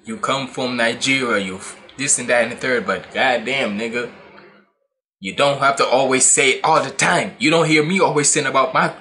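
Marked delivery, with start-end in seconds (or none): none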